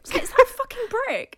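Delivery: very high, loud comic protest; playful exasperated outburst; mock outrage. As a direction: protest indignantly, hamming it up. Comic outrage over the brick.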